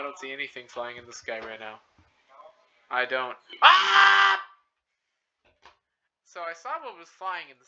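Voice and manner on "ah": high sudden shriek; startled scream. Real, not acted. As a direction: scream in surprise. Sudden scare in game.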